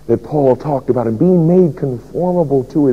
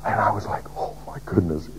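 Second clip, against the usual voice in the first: whispered awe; 5 semitones lower, 10 dB quieter, faster than usual. Retold or imitated. Awed whisper in testimony.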